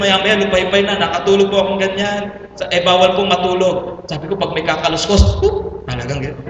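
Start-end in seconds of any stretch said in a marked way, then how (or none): 5.4-5.9 a sudden high jolt of a yelp; startled yelp. Retold or imitated. Pastor retelling how he jerked awake, startled yelp. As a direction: jump at a noise in the dark.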